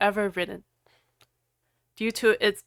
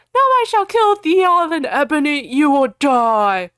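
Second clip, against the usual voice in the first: high, loud dark lord voice; hammy villain threat; 5 semitones higher, 7 dB louder than usual. Acted. Voldemort threatening Ebony, hammy. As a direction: roar the threat like a panto villain.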